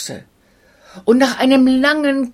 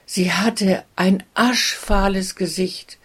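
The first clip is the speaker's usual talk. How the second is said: ordinary delivery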